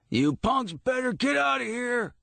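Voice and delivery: high furious shout at punks; screaming. A narrator's reading. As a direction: bellow them off the lot. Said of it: "Florian screamed".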